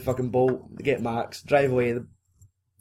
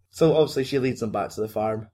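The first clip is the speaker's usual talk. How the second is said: normal talking voice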